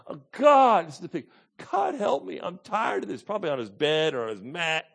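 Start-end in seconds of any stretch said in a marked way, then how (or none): 0.1-0.9 high, loud cry on God; acted cry of anguish. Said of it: "he's just crying out to God". 1.7-3.2 high, weary plea; acted anguished prayer; crying out to God. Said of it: "he's just crying out to God. He's hurting so bad".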